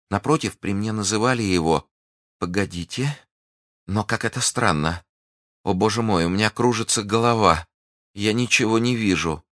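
lifted, faltering heroine voice; faint young heroine. Acted. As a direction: speak as if growing dizzy.

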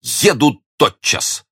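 high, drawn-out declaration; elated hero, pitched high and slow; proud joy. A narrator's reading. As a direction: stretch it out with eager resolve. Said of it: "сиял от гордости и счастья".

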